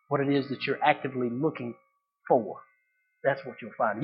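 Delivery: low, soft, spaced-out phrases; hushed, solemn preaching. Real, not acted. Preacher lands the point for the congregation, softly and solemnly. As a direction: drop low and let each phrase sink in.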